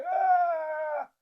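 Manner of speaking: long, high-pitched mock wail; mock scream. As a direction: let out a loud, drawn-out put-on cry. Acting out his later reaction.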